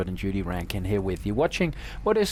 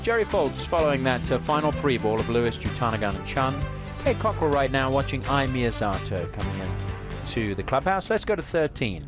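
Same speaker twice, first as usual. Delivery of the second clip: upbeat broadcast opener, higher pitch; golf commentator; 4 semitones higher than usual. Acted. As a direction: welcome viewers like a TV golf host. Opens the broadcast over music.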